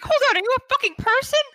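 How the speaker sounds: high, loud call across the water. Acted. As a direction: shout out to him in disbelief. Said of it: "call out to him and be like".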